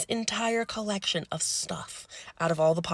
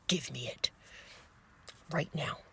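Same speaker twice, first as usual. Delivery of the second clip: low, hushed nurse's order; quietly menacing, voice held down; stern low demand; 3 semitones lower, 9 dB quieter than usual. Retold or imitated. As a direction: demand it under your breath. Furious nurse confronts patient, retold.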